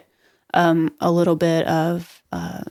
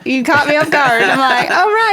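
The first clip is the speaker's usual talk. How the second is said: laughing; 7 semitones higher, 4 dB louder than usual. Real, not acted. Flustered after twofer joke.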